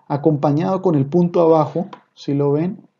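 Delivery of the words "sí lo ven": normal talking voice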